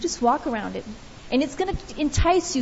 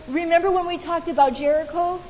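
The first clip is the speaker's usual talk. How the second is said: ordinary talk, nothing marked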